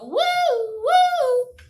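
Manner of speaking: high singsong woo. Pretend. Kid on porch mimicking her yo-yo, high singsong. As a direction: mimic the yo-yo spinning. Yo-yo sound effect.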